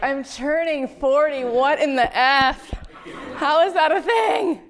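high, slow disbelieving wail; laughing mock horror at turning forty; mock panic. Retelling own panic at turning forty.

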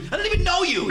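high mock-indignant outburst; exasperated protest. Prank victim refusing to sponsor a stranger, exasperated protest. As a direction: imitate a flustered man, pitch way up.

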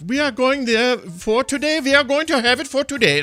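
broad, sustained German-accented demo; common German accent. Shown as a demonstration. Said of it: "a lot of different sort of".

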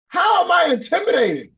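high, loud disbelieving shout; loud exclaim. Real, not acted. Host protesting to viewers that he isn't scary, loud and high. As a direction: protest it at full volume. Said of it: "this is the loudest I ever talk".

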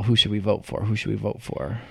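hushed, fading mock whisper; imitating a whisper. Mocking cohost's whisper on The Amazing Race, hushed. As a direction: murmur it like a secret. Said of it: "you were all".

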